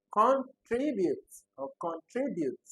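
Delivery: "contribute" said twice with misplaced stress, high and loud; wrong stress demos. Shown as a demonstration. Teacher rejecting wrong options, exaggerated stress on "contribute". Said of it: "Definitely not".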